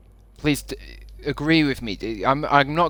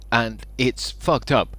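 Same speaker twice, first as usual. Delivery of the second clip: ordinary talk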